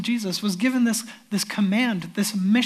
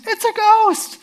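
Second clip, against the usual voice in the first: high, loud panicked shriek; terrified scream; 12 semitones higher, 6 dB louder, slower than usual. Acted. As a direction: shriek it like a sailor seeing a ghost. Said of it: "someone in the boat screams".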